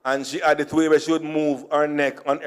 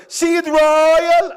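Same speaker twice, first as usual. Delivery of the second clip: mocking voice; 12 semitones higher, 4 dB louder than usual. Mock voice of a Jamaican.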